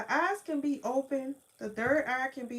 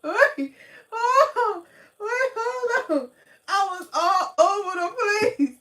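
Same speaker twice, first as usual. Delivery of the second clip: high, louder, laughing through the words; laughing hard; 8 semitones higher, 8 dB louder than usual. Real, not acted. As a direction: crack up so hard the words wobble. Laughing at own story.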